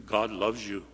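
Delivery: low, soft, humdrum; a stock phrase said out of habit; routine church phrase. Retold or imitated. Preacher mimicking the worn-out church line, low and soft. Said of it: "And we hear".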